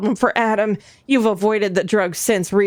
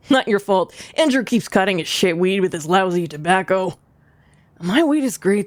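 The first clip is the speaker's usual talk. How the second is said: male voice; 3 semitones lower than usual. Acted. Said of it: "differentiating my, my male voice".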